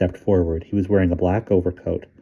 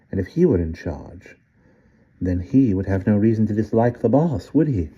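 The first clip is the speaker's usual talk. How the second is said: put-on sly old British gentleman; 2 semitones higher than usual. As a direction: coax the boy like a sly old tempter. Old man tempts Fergie.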